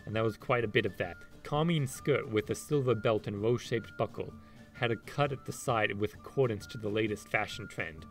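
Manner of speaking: story narration. Acted. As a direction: read it like an audiobook. Reads a passage from the books.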